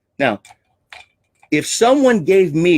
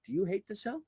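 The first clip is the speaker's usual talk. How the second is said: suddenly very soft, higher-pitched question; theatrical hush; 5 semitones higher, 17 dB quieter, faster than usual. Shown as a demonstration. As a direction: drop to a hush to draw them in. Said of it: "theatrics just to get your attention".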